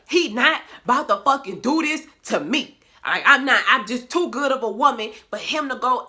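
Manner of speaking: loud, high, fed-up rant with a laugh breaking through; indignant outburst. Retold or imitated. Telling the viewers how mad she got at her man, loud indignant rant. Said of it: "I was like".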